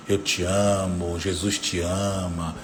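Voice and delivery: low, slow, gentle reassurance; tender affirmation. Acted. Parent to child on waking, tender affirmation. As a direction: softly affirm a child with love. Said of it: "dizer palavras pra ele de afirmação".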